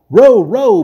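high, loud bellow to the rowers. Acted. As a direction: bellow the order over the oars. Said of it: "bellowed Caspian".

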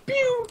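tiny high, soft "pew"; imitated shot noise. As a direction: make a little high laser-shot sound.

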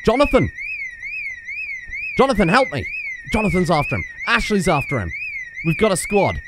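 real frantic high yelling; frantic excited yelling. Real, not acted. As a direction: scream for help mid chase. Frantic chase, calling for help.

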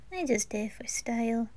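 quiet shy reply; shy whisper. Acted. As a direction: mumble it away, embarrassed. Shy, depressed character answers.

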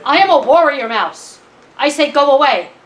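bold, emphatic command; persistent, defiant mouse. Acted. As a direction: declare it like a self-important warrior. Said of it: "Mouse persists".

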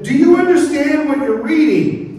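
high, loud shouted question; calling out. Acted. Philip runs beside the Ethiopian's chariot, calling out. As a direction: call up to someone in a moving chariot.

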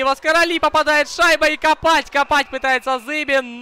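high, loud rising commentary rush; excited play-by-play. Real, not acted. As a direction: call the scramble at the net, shouting. Live hockey attack near goal.

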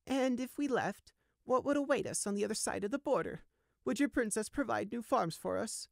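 put-on a high, wary old ewe pressing with pointed questions; old sheep voice. Old ewe challenging the guard: high, wary.

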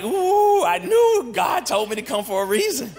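high, loud giddy squeal then shout; excited mock gasp. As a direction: play the thrilled churchgoer, loud. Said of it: "And you're like".

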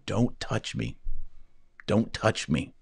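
Christ's hushed warning. Acted. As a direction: speak as Jesus, quiet but firm. Voicing Christ to Mary Magdalene.

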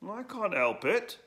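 high, slow and plaintive; Edwo voice. Acted. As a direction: whine it like a boy. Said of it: "said Edwo".

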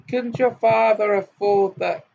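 mock the hovel to Tom; haughty girl. A narrator's reading. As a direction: rich girl teasing her companion.

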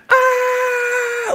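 long, loud, high-pitched scream; mock death scream. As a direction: scream as loud as you can like a bad actor dying. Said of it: "Then he just screams again".

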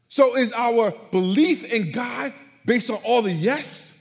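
loud, high preacher's voice, building to a peak; full-force preaching. Real, not acted. Preacher to congregation on tested faith, loud impassioned preaching. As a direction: preach it out loud and high, leaning on key words.

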